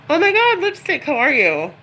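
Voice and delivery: squealing high, loud hello to a friend; light up and shout the hello; thrilled to see a familiar name in chat; excited greeting. Real, not acted. Greeting a viewer on livestream.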